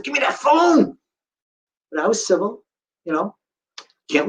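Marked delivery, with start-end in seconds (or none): 0.0-1.0 exasperated inner yell. Retold or imitated. Frustrated urge to grab phone.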